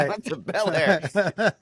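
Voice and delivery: high, slow, laughing boast. Real, not acted. Patient playing along with friends' teasing, laughing. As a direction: draw it out through real laughter.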